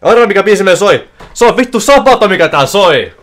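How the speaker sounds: real thrilled streamer chatter; hyped up. Real, not acted. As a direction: still buzzing, talk it out loudly. Big slot win, still hyped.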